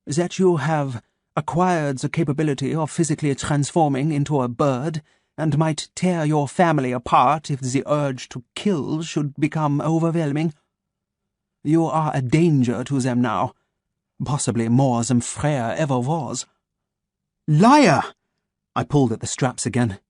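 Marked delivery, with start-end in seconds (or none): 0.0-16.5 measured German doctor, each word placed; a calm German voice waking his captive; German accent. Acted. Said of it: "his German accent crisp and sharp". 17.5-18.1 angry shout. Acted. Boy accuses captor, pulling straps.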